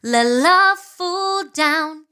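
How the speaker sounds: "Let love fall down" drawn out slowly, notes held; slinky and slow. Shown as a demonstration. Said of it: "when you see a snake going like this".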